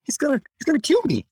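frightened squeak at the end; scared Esteban. Retold or imitated. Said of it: "He's like".

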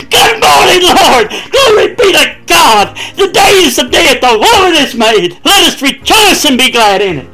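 a beaming, rejoicing greeting to the Lord; joyful morning shout. Retold or imitated. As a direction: shout it like you just woke up happy. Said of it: "you'll get up and say".

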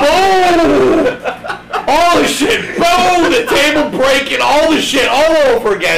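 high, loud laughing shout; excited laughing yell. Real, not acted. Reenacting crashes, laughing.

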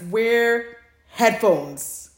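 slow, loud, drawn-out warning; emphatic warning. Shown as a demonstration. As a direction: stretch each word out as a stern warning. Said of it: "I say this with the utmost caution".

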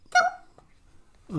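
high, loud shouted thump. Acted. Sound effect in story.

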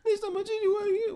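very high, squeaky mimicry; high-pitched ridicule of a tough guy; mocking threat. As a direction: parrot the threat in a silly high voice. Mocks the naked man's threat.

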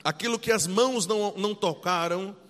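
fervent, loud preaching. Acted. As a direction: preach it with full force. Fervent scripture reading and preaching.

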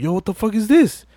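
high-pitched mock bewilderment; retold alarm. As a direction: act out a startled, indignant question. Voicing Brian alarmed by bikers.

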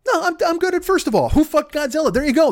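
high excited insistence; high-pitched excited. Real, not acted. Insisting on the first question, high excited. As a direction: squeak it out insisting. Correcting own rant.

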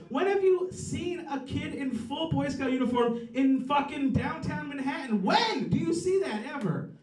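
hammed-up shock at the sight; incredulous outburst. Comic retelling his street shock, high mock yell. Comic reacts to Boy Scout.

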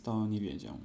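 usual speaking voice

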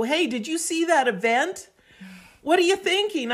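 eager friend calling, voice climbing high; a friend buzzing with news on the phone; excited friend. Retold or imitated.

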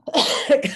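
sharp loud cough; choking cough. Real, not acted. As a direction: cough it out hard. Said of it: "I'm literally choking on my own spit".

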